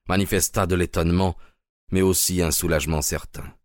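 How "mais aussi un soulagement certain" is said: ordinary delivery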